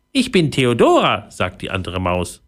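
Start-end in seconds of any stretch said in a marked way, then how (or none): 0.1-1.3 high, perky mouse voice; squeaky little voice. A narrator's reading. Mouse introducing herself to Pauline, high squeaky voice. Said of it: "ein piepsendes Stimmchen".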